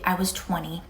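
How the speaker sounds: usual speaking voice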